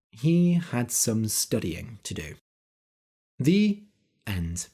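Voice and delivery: calm, clear storytelling for English learners; story narration. A narrator's reading. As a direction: read the tale steadily to your listeners.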